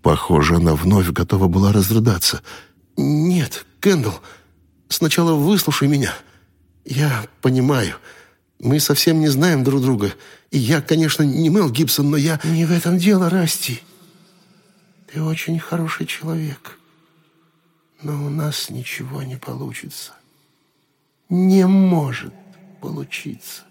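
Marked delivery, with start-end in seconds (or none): 3.0-12.4 higher, urgent pleading voice; pleading man. A narrator's reading. 12.4-23.6 high, softer and softer to a whisper; a ghost voice dissolving in fog; fading ghost. A narrator's reading.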